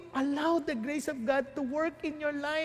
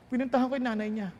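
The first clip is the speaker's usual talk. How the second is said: ordinary talk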